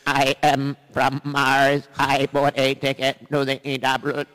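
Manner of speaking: loud, slow, stilted alien voice; Martian visitor. Pretend. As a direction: speak like a robotic spaceman. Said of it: "somebody from outer space".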